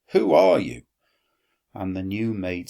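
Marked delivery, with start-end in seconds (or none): 0.1-0.8 louder, much higher indignant question; indignant demand. Acted. Metella confronting the new slave girl, indignant and loud. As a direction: demand it with sharp surprise.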